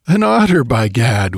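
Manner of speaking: high, loud burst, then dropping; amused exclamation. A narrator's reading. Both men spotting it was an otter, exclaiming. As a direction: exclaim it in relieved surprise. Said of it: "we exclaimed in the same breath, laughing".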